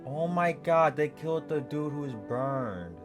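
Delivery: usual speaking voice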